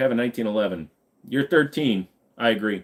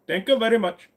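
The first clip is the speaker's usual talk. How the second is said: higher, singsong put-on Indian accent; playful Indian accent, pitched up; 6 semitones higher than usual. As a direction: explain it all in an exaggerated Indian accent. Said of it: "your Indian accent is hilarious".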